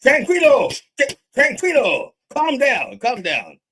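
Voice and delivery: high, loud calling Tranquilo; father yelling. Retold or imitated. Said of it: "So he goes like".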